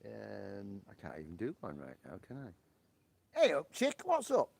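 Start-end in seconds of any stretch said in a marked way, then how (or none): 3.3-4.5 high, loud West Yorkshire greeting; West Yorkshire accent. Acted. Greeting the chick in a high, loud West Yorkshire accent. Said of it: "I need a West Yorkshire accent".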